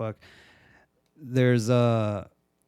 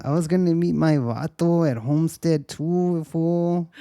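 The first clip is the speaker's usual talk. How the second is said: higher hammed-up Chicano accent; mock Chicano accent; 5 semitones higher than usual. Woman yelling at the android, hammed-up Chicano accent. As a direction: whine about missing your vato, Chicano style.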